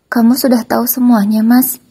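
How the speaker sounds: high, loud desperate begging wife; pleading ex-wife. Acted. Ex-wife begs husband at police station, high desperate pleading. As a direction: beg him to free you, voice pitched high.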